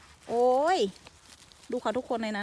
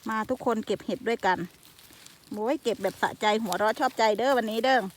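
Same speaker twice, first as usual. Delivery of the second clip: normal talking voice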